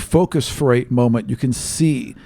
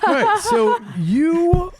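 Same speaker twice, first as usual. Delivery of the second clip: laughing; 13 semitones higher, much slower than usual. Real, not acted. Group laughing fit.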